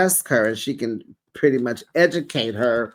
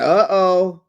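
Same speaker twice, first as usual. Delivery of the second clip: mock uh-oh; 4 semitones higher, 5 dB louder than usual. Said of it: "I was like".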